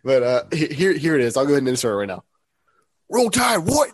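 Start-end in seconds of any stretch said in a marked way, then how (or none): 3.1-3.9 high, loud Coach O voice, well above own pitch; Coach O impression. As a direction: belt it out like the LSU coach in the locker room. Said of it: "we heard Coach O".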